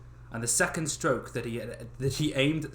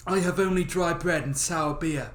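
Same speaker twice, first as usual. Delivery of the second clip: simple lad's put-on voice; Dummling's voice; 3 semitones higher, 3 dB louder than usual. Dummling offering his humble food to the old man. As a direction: offer it plainly like a kind simple lad. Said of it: "what was his voice again?".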